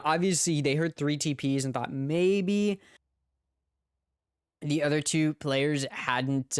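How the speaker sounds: normal talking voice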